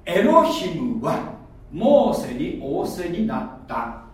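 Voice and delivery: slow, deliberate scripture reading; slow careful reading. Shown as a demonstration. Preacher reading the original text to the congregation, slowly. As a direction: read each word out slowly. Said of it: "これ原文に沿って言いますよ".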